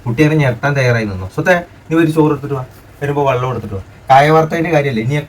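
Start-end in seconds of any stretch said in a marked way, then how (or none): none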